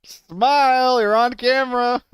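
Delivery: high, loud sing-song call; mock photographer cheer; cheery photographer. Playful photo-op announcement.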